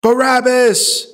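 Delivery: loud, high, drawn-out cry; crowd shout. A narrator's reading. Crowd answers Pilate's question, shouted. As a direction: call it out like a whole crowd. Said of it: "The crowd shouted".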